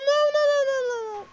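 high whining no's, near tears; hammed-up falsetto wail; mock whimper. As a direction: whine it like a kid about to cry. Failing the puzzle.

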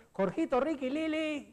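mock yell to rouse the kids; imitated father's shout. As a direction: call out the names like Dad at dawn, without full volume. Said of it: "gritaba así".

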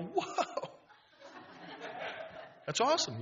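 high, laughing whoa of delight. Retold or imitated. [name] reacting to Dave's intro with laughing delight. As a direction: let the laugh lift the whoa. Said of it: "I go".

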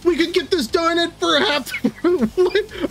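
breaking into laughter mid-line, pitched high; laughing. Real, not acted. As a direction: laugh at the absurd text. Laughs reading absurd AI text.

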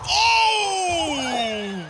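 a high, loud wail as the putt misses; anguished cry. Real, not acted. Gamer crying out as the putt slides past. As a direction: cry out in real dismay. Ball nearly drops in.

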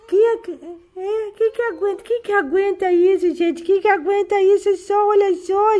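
high, gushing pet-doting coo. Real, not acted. Grandma dotes on her kitty, high cooing baby talk.

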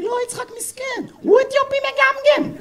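high-pitched caricature, slowing at the end; mimicked friend. Friend explaining poor Yitzhak's stammer, high mimicking voice. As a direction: answer in the high ringtone voice.

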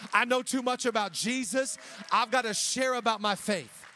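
loud, insistent, building declaration; impassioned preaching. Acted. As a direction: hammer each phrase out with unshakable conviction. Preaching at full force as Peter.

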